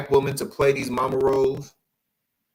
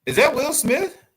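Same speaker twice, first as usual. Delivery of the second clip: higher, incredulous question; surprised; 14 semitones higher, 4 dB louder than usual. Real, not acted. Asking viewers in shocked disbelief. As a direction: disbelief at who it is.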